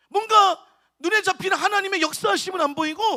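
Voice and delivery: ordinary talk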